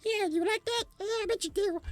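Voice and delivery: very high, teasing Muppet-like squeak; mock the dad's silly bedroom voice, way up high; dad's weird voice. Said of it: "weird fucking shit with that voice of yours".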